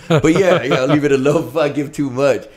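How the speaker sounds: ordinary talk, nothing marked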